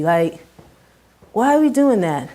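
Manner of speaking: usual speaking voice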